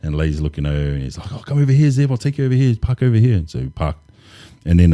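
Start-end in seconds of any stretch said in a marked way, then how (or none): 1.3-3.4 higher-pitched, imitated helpful call; eager welcome. Worker shows visiting recruiter where to park, eager welcome. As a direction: usher someone in with keen, high-voiced helpfulness.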